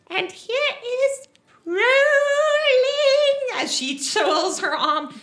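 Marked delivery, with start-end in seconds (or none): 0.1-3.6 high, drawn-out showman's call; showboating gnome woman. Acted. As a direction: stretch it out loud and high like a strongwoman's boast. Gnome shows off her arm muscles.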